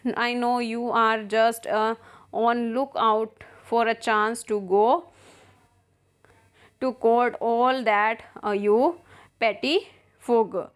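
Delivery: reads the English lines slowly, word by word; slow play reading. Acted. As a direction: spell out each line of the play for the class. Teacher reads play aloud. (धीमा नाट्य पाठ)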